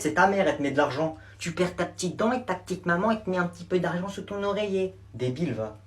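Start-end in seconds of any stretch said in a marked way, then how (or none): 1.4-4.9 sing-song mocking voice for a little kid; mock baby talk. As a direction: mimic a sweet voice to mock him. Moquerie en imitant une voix bébé. (voix moqueuse de bébé)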